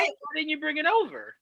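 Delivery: high-pitched exasperated question; exasperated complaint. Acted. Fan griping about an actress's accent, exasperated.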